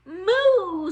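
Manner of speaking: loud mooing call; cow moo. Acted. Cow revealing itself under the mask, loud moo. Cow animal sound in story.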